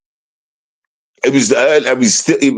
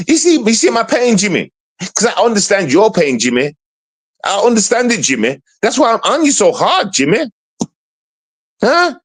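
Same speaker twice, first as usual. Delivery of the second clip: heated rant; 8 semitones higher than usual. Real, not acted. Ranting straight at Jimmy, heated and high. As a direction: pitch climbs as he berates him.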